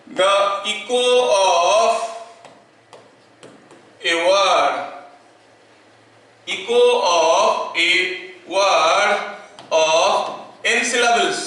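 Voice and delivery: very slow, word-by-word dictation pace. Shown as a demonstration. Teacher dictating a definition to students, slow and spaced. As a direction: spell the definition out slowly for notes. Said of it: "मैं इसको details में लिखूंगा".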